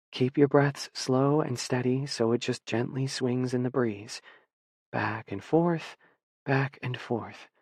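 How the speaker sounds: slow, soft soothing voice; calm and deliberate. A narrator's reading. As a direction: ease him to sleep, slowly. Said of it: "each word calm and deliberate".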